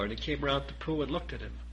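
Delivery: ordinary delivery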